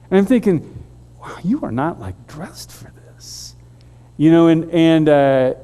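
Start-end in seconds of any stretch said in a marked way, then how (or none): 1.2-3.5 softer muttered thought to himself; inner thought. Retold or imitated. Thinking about the neighbor's clothes, a soft private mutter. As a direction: keep it private and softer, under the breath.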